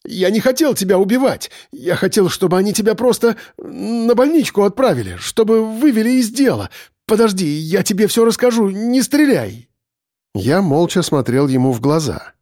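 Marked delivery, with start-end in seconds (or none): 0.0-9.7 high-pitched, desperate confession; panicked pleading. A narrator's reading. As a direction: plead for life in a high, urgent voice. Pleading for his life at gunpoint.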